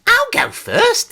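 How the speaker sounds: put-on very high, slow child's voice; squeaky goody-goody child; good little boy. A narrator's reading. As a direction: offer in a high, prim little voice. Peter volunteers, goody-goody child.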